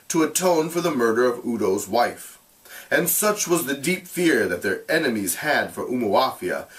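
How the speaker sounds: measured, expressive read-aloud; storyteller reading a classic novel aloud; story narration. A narrator's reading. Teacher reads novel aloud to class.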